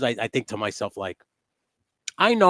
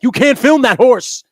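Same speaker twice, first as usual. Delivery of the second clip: a much higher, louder mock yell from the vendors; mock angry yell; 8 semitones higher, 9 dB louder than usual. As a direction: ham up the vendors scolding him, high and loud. People yelling at him, retold.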